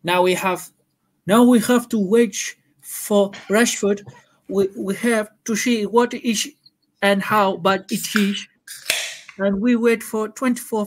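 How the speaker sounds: put-on slow, halting Dutch-accented English. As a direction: imitate a Dutch football manager, slow and deliberate. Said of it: "In your best Dutch accent".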